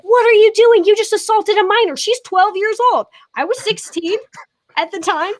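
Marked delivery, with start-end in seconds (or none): 0.0-3.1 high, loud imitation of an outraged stranger; scolding a man for shoving a kid, loud and high; retold indignant shout. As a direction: recreate her shouting at the old man. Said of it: "And she's like".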